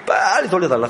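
mildly hammed-up impatient demand; mock angry demand. Friend wanting his sin returned quickly, mock angry. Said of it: "갑자기 이 친구가 막 화를 내면서".

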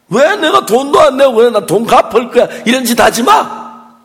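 loud, high acted outburst; retold angry protest. Wife rejecting the collector's receipt, loud and indignant. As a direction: protest at full voice, slowing on the last words.

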